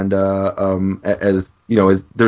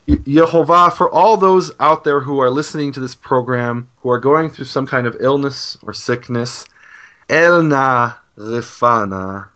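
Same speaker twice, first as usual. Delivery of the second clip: praying for sick listeners on the program; heartfelt prayer; 2 semitones higher than usual. Real, not acted. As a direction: pray sincerely for the listeners. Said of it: "can I have one more second just to pray".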